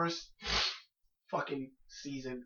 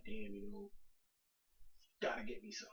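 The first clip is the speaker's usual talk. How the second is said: much softer, lower whisper; whispered craving; 4 semitones lower, 10 dB quieter than usual. Retold or imitated. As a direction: whisper it like a secret urge. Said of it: "I'm at that point where I'm like".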